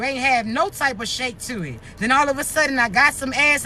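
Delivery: usual speaking voice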